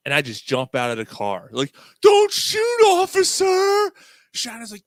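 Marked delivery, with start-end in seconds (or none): 2.0-3.9 very high, loud yell, last word drawn out; mock panicked shout. Prankster jumping out at police stop, high mock-panicked shout. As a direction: holler it up high like a mock surrender.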